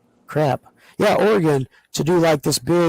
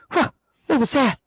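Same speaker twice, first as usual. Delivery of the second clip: high startled huh; 9 semitones higher than usual. Retold or imitated. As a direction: act suddenly puzzled by a smell. Mock reaction to a smell.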